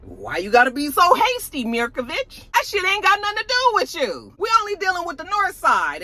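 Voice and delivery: high-pitched mock indignation; indignant protest. Retold quarrel over a deal.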